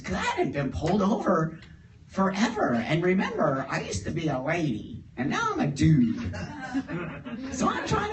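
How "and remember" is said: usual speaking voice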